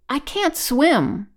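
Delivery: high, loud, slow wail; stricken. A narrator's reading.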